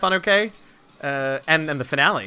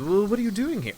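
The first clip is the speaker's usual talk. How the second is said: higher-pitched in-character question; player character Harmony; 7 semitones higher than usual. Acted. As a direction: ask it in a lifted, lighter character voice. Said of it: "then Harmony, my character, says".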